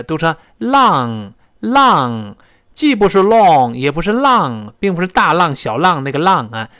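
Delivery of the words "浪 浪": "浪" said like Chinese lang, falling; wrong Chinese-style "long"; too wide, wrong. Shown as a demonstration. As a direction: say "浪" with a flat Chinese vowel. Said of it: "声音又太往两边拐了".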